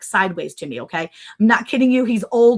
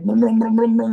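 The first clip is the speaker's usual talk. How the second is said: truck engine imitation; 4 dB louder than usual. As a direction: mimic a big truck rumbling up. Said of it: "this big truck, and it's like".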